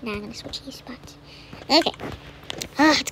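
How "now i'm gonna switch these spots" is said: whisper. Real, not acted. Quiet aside while playing.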